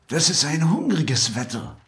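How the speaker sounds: high-pitched fox character. A narrator's reading. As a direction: complain in a high storybook voice. Fox speaks to the hare.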